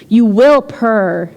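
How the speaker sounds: slow, even chant of "You will purr."; hypnotic murmur. Acted. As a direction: lull them like a hypnotist, very slowly. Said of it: "in a hypnotic murmur".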